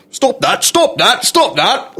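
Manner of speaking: put-on high-pitched Monty Python-style scolding accent. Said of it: "Monty Python".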